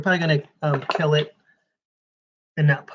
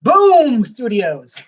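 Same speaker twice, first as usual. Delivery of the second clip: mock announcer; 5 semitones higher, 6 dB louder, much slower than usual. Announcing publisher loudly.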